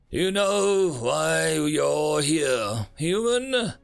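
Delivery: slow, high, otherworldly alien ruler; menacing alien leader. Acted. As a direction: loom over him with slow menace. Alien leader confronts the human.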